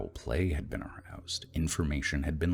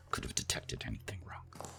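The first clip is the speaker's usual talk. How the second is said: whisper; 3 semitones lower, 10 dB quieter than usual. Acted. Gloating narration, unvoiced.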